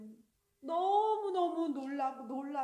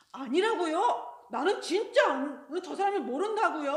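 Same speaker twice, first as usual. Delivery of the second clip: much louder, rising denial; heated denial; 2 semitones higher, 9 dB louder than usual. Acted. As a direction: snap back louder each time. Peter's second, heated denial.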